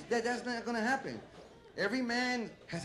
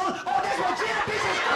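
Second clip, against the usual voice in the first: high, loud party squeal; excited partier; 10 semitones higher, 6 dB louder than usual. Acted. A woman in a club restroom hearing her song, squealing with glee. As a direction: yell it like your song just came on. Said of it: "you hear your fucking favorite song".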